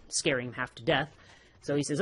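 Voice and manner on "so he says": ordinary delivery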